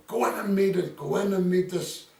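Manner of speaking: high, loud shouted order from up on the scaffold; loud mock shout; retold shouting. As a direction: yell it up at him like a foreman. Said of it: "this Italian kept shouting".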